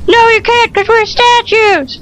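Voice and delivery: high whining protest; mock-frightened pleading up high; whiny scared figure. Scared statue arguing back, whiny.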